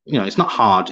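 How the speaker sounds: usual speaking voice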